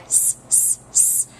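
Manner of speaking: "s" as a long, soft unvoiced hiss; hissed s sound. Shown as a demonstration. Teacher showing young readers the soft C sound, hissed. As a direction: hiss the "s" so the kids hear it. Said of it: "If I wanted to get a soft C sound".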